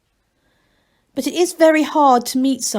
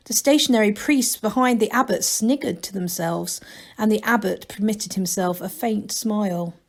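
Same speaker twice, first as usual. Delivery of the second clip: usual speaking voice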